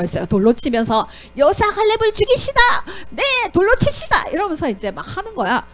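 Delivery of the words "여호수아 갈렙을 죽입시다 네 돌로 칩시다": high, fierce mob call; hammed-up mob shout; angry mob. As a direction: rouse the crowd to stone them. Mob calls to stone them.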